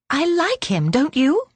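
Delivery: put-on high child's voice, rising question; eager young girl. A narrator's reading. Belinda seeking Daisy's agreement, eager young girl. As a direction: ask it like an earnest little girl.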